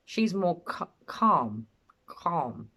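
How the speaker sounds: imitating him stumbling on "calm", cutting it off and then repeating it; struggling over calm. Host mimicking the father stumbling over the word, halting. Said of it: "He doesn't pronounce the word calm correctly".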